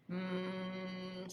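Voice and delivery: long, soft sustained hum; robot snore. Acted. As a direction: snore as a drawn-out mechanical drone. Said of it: "snored Robot".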